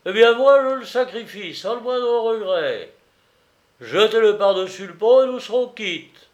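high, emphatic theatrical flourish; grand declamation. A narrator's reading. Benjamin offers his nephew's coat to be quits, grandly declaiming. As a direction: proclaim the sacrifice with a loud opening.

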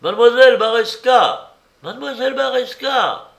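high, loud shout through a door; calling out. A narrator's reading. Searchers knocking at mademoiselle's door, calling out loudly. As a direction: call her name to rouse the house. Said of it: "Alors ils appelèrent".

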